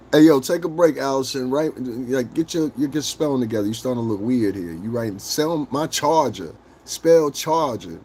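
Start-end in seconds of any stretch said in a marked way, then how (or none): none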